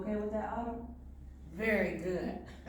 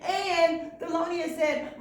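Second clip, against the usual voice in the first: plain reading voice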